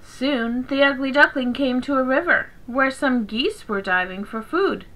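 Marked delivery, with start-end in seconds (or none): none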